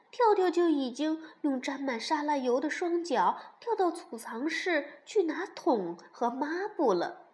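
usual speaking voice

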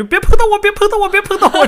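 shrieking high and loud in mock panic; mock panicked squeal. Host acting out her parked car's panic, shrieking. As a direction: squeal it like the car's being attacked. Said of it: "这种感觉".

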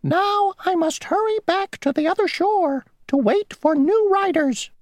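very high, squeaky insect voice; a tiny, prim rule-keeper; a tiny mosquito insisting on his rule. Acted. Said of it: "said the mosquito".

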